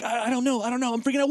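high, stammering mock panic; flustered panic. Imitating Demi Moore's character freaking out, flustered.

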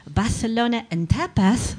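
"Barcelona" and "tapas" with exaggerated th sounds; affected Spanish lisp. Shown as a demonstration. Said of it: "pronouncing Barcelona and tapas with a lisp".

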